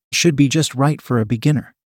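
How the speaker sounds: ordinary delivery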